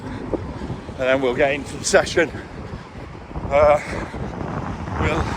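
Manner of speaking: breathy jogging voice, pausing for air; while running. Real, not acted. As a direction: talk between strides on the road. Back out running, interval warm-up.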